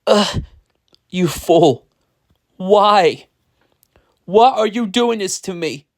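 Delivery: high, strained groaning plea; weakly. A narrator's reading. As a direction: groan it out as if beaten. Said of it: "he said weakly".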